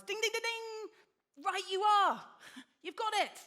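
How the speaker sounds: high, loud quiz-host cheer with a laugh; hammed-up game show winner call. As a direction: ring out the correct answer like a TV host.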